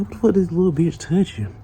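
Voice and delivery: high, loud mock outrage; acted indignant disbelief. Retold reaction to being touched.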